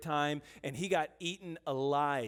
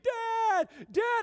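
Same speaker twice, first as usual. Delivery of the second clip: very high mock yell for dad; imitated child's scream; 4 dB louder than usual. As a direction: holler like a distressed little boy. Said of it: "he's screaming".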